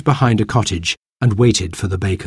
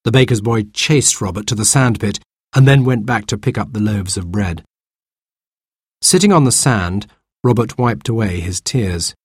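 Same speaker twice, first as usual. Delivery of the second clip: ordinary delivery, nothing marked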